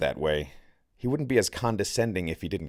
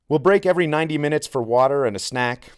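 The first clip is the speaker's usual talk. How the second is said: upbeat briefing; 7 semitones higher, 8 dB louder, faster than usual. A narrator's reading. Leader briefing the group.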